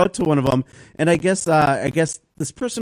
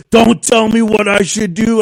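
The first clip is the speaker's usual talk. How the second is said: louder, higher, slow angry retort; imitated defiance; 6 semitones higher, 7 dB louder than usual. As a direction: snap back slowly and loudly. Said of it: "He goes".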